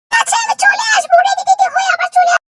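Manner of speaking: very high squeaky voice; reply in a tiny cartoon squeak; squeaky granny character. Granny promising to come over later, high squeak.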